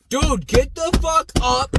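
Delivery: slow, loud, punched command; urgent yell. Retold or imitated. Telling buddy on the ground to get up, urgent shout. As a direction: bark it to get him moving.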